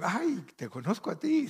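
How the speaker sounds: high-pitched imitated exclamation; mock exclamation. Retold teasing exchange, acted out. (exclamación imitada en voz aguda)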